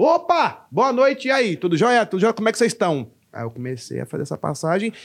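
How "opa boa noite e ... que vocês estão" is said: much higher, bright and lively hello to the crowd; energetic stage greeting. Shown as a demonstration. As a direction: greet the audience like entering a friend's house. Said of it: "Eu falo". (saudação animada ao entrar no palco)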